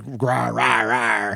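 mock dinosaur growl, low. Imitating the Indominus Rex talking to the raptors, mock growl. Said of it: "Literally makes, like, dino".

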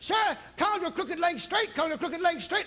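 building insistent command. Retold or imitated. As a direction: hammer the words home, rising in force. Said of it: "the longer I went, the louder I got".

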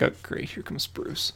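unvoiced muttered aside; whispered grumble to himself; mock whisper. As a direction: breathe it under your breath, unimpressed. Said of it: "He's like".

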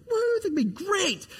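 put-on high, gleeful whoop of kids; squealing mock excitement; excited woo. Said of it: "we're like".

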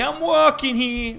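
loud, high, drawn-out Boston yell; Boston accent. Shown as a demonstration. Demonstrating Boston for co-hosts, shouted Boston accent. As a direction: holler it slowly in broad Boston. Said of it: "Boston accent is like".

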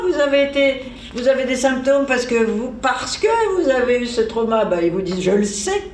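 ordinary delivery

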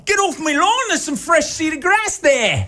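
very high hammed-up shout in a shaky Australian accent; mock Australian accent. Host plays the homeowner chasing the press off the grass, bad Australian accent. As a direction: bellow it like a cartoon Aussie neighbour, slowing at the end. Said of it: "your accent was a little more Oliver Twist".

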